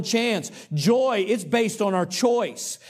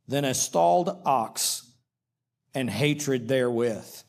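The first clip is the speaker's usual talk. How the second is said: measured, solemn reading of the verses; scripture reading; 4 semitones lower than usual. Acted.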